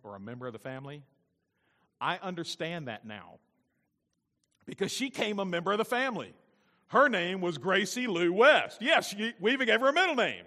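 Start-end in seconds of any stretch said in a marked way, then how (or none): 4.7-10.4 raised, loud preacher's delivery; loud, high-pitched pulpit emphasis; emphatic preaching. Real, not acted. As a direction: proclaim it high and strong to the congregation.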